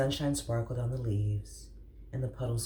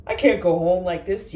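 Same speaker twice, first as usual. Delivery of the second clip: higher, loud yell; 7 semitones higher, 11 dB louder than usual. Acted. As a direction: yell it in despair. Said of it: "yelled Armadillo".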